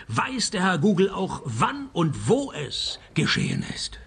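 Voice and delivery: normal talking voice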